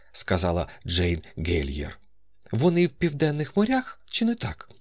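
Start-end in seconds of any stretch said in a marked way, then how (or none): 2.5-4.7 very high female character voice; high, questioning lady in the story; woman character's questioning voice. A narrator's reading. Narrator voices Jane Helier's question.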